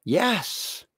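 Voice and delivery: a high, firm yes; an emphatic pulpit yes. Real, not acted. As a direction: land the yes with full conviction. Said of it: "My answer is an emphatic yes".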